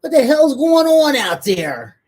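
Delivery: put-on loud, slow, stern yell at noisy kids; mom voice. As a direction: bellow like an angry mom. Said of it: "With your mommy voice?".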